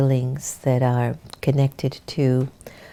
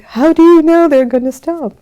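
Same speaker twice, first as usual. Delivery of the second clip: high, louder, anxious question; mock worried; 13 semitones higher, 11 dB louder than usual. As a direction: play your own nervous self. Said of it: "And I'm like".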